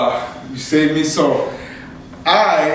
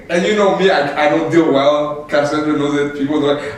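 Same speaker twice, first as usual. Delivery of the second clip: ordinary talk, nothing marked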